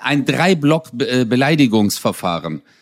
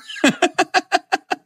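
laughter. Real, not acted. Laughing at the insult demo.

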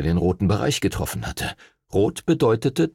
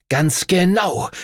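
slow, high, menacing; hissed; 8 semitones higher, slower than usual. A narrator's reading. Rival before lunging at Henry, hissed menace. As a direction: draw it out as a threat. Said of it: "zischte Darius".

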